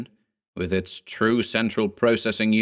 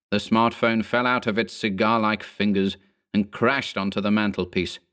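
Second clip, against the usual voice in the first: ordinary delivery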